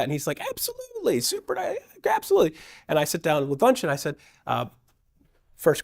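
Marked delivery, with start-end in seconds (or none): 0.5-2.6 high, bubbly fake-friendly yes; high eager gushing agreement; over-eager yes. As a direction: sell it like a grinning salesman. Eager agent's sudden reply.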